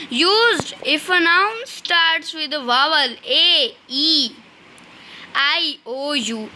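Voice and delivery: slow, spelled-out reading of the rule; slow dictation reading. Shown as a demonstration. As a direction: read it out word by word for students to copy. Teacher dictating rule slowly. (धीमी श्रुतलेख शैली में पढ़ना)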